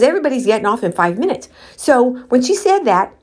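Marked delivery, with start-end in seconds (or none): 0.0-1.5 mocking rapid repeat. Mocking the staff's excuse.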